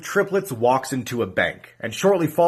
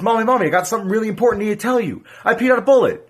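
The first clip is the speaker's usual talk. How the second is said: put-on excited child; 6 semitones higher, 3 dB louder, faster than usual. Daughter runs to mother.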